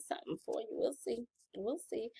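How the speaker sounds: hint at a secret prize in a whisper; teasing whisper. Real, not acted. Teasing a giveaway.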